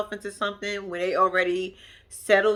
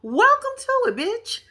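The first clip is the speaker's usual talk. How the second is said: sassy mock welcome; 13 semitones higher than usual.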